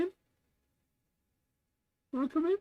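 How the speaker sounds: very high, rising pet-talk voice; talking to a cat. Real, not acted. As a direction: coax the kitty sweetly. Coaxing cat into box.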